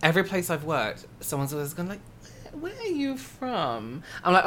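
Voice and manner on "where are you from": high, slow, soft imitation of a curious stranger; nosy questioning. Coworker asking about her origins, mock curious. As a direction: mimic a prying question, drawn out high.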